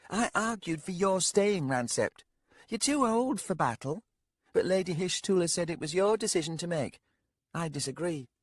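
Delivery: put-on a sharp-tongued young lady, needling the old man. Young lady voiced by narrator.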